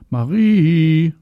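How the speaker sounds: high, loud, drawn-out shout; calling out. A narrator's reading. Mama searches for hidden Marie, calling out loudly. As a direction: holler the name from a balcony. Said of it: "ruft Maries Mama vom Balkon".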